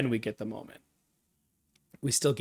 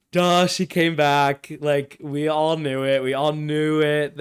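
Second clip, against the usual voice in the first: scoffing; 7 dB louder than usual. Real, not acted. Fan scoffing at obvious twist.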